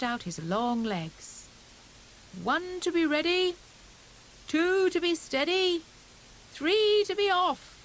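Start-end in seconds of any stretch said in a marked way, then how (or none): 2.4-7.8 high, loud countdown call; excited cry. A narrator's reading. Hare calling the start to his friends, excited shout. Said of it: "he cried".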